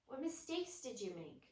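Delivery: usual speaking voice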